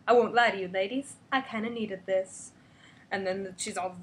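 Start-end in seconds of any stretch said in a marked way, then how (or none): none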